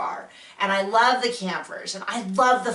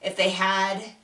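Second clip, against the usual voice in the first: normal talking voice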